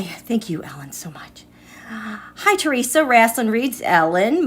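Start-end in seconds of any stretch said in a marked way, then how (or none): 0.0-1.4 whispered thanks, almost no voice. Real, not acted. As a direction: breathe the thank-you under the breath. Aside thanking donor mid-greeting.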